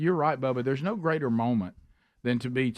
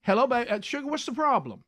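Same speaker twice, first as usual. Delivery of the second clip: much higher sing-song agent voice; call center impression; 9 semitones higher than usual. Host imitates the agent picking up after hold, high mock voice. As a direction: coo it like a syrupy phone agent. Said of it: "and then you're on hold and you hear".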